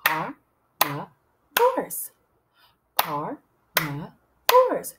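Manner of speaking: CARNIVORES in three separated beats; syllable by syllable. Shown as a demonstration. Teacher claps syllables with children, word split in three. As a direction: break "Carnivores" into car, ni, vores, very slowly. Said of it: "Can we clap the syllables for carnivores?".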